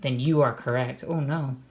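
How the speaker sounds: ordinary delivery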